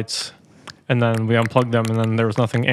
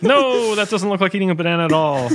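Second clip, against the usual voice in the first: mock objection; 7 semitones higher than usual. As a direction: object playfully in a raised voice. Acting out a game partner's objection.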